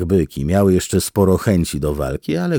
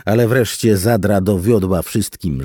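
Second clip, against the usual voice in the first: ordinary delivery, nothing marked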